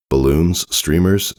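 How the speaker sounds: usual speaking voice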